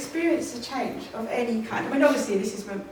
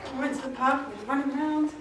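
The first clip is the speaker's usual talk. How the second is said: usual speaking voice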